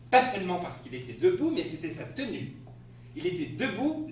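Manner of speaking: usual speaking voice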